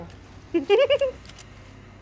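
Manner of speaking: relieved laugh. Real, not acted. Relieved laugh after escape.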